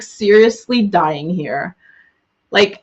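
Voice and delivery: ordinary talk, nothing marked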